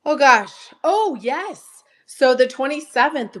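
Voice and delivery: usual speaking voice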